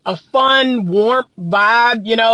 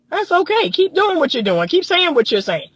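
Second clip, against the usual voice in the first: higher, mock-agreeable voice; mock permissive; 7 semitones higher than usual. Woman signalling to a disrespectful man, mock approving. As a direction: imitate giving easy approval, pitched up.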